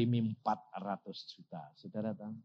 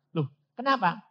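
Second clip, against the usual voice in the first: ordinary talk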